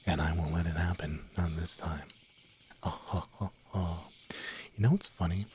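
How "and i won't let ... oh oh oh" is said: ordinary delivery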